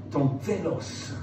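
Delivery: normal talking voice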